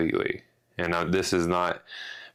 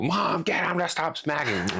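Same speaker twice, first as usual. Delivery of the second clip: put-on whiny kid; 9 semitones higher than usual. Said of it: "I used to be so mean to my brother".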